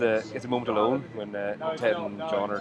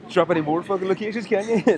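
higher, louder teasing jab; mock annoyance; 8 semitones higher, 6 dB louder than usual. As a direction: rib your friend for name-dropping. Teasing co-host about name-dropping.

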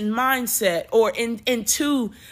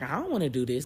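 retold reluctance; 6 semitones lower, 5 dB quieter, much faster than usual. Retelling own reluctance to God.

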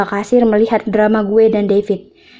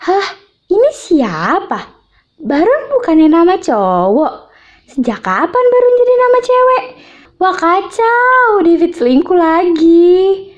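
shocked; 9 semitones higher, 3 dB louder than usual. Acted. Shocked to find cheating.

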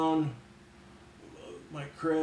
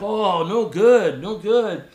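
slow, loud mock Mexican accent; dismayed Mexican captain; 5 semitones higher, 9 dB louder, much slower than usual. Captain hears horse fell, dismayed Mexican accent. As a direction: lament in a high Mexican-accented voice.

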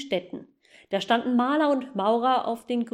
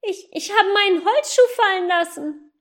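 put-on boy's voice; 9 semitones higher, 7 dB louder than usual. Boy calling to the goose.

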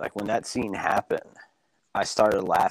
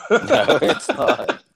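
laughing out loud, higher; 14 semitones higher, 8 dB louder, much slower than usual. Real, not acted. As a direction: burst out laughing. Laughing at the broken audio.

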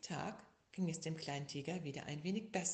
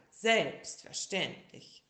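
slow, drawn-out pompous word; grand doctor; 2 semitones higher, 7 dB louder, much slower than usual. A narrator's reading. Frog doctor granting the bear the same, slow. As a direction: stretch it out grandly. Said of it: "sagte Doktor Brausefrosch".

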